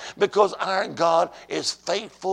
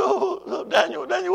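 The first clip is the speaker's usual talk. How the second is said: high-pitched acted sobbing call; imitated tearful wife; 12 semitones higher than usual. Wife cries to husband on the phone, tearful and high. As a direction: cry out his name in distress. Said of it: "She was shaking up, tear, crying".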